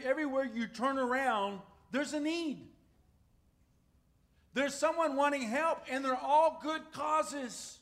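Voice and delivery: preaching. Acted.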